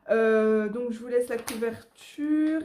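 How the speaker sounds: ordinary talk, nothing marked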